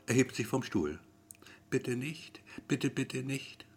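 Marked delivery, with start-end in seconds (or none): 1.7-3.6 soft, hushed inner plea; understated, murmured pleading; silent pleading. A narrator's reading. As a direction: beg quietly under your breath. Silent plea repeated, dreading call.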